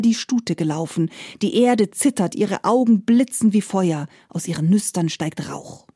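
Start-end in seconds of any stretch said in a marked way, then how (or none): none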